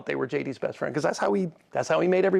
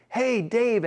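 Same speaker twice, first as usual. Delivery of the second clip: fake excitement; 8 semitones higher than usual. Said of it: "being treated like a transaction is".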